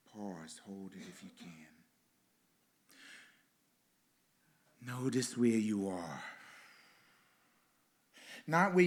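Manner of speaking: guided meditation. Acted. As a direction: lead the congregation into stillness gently.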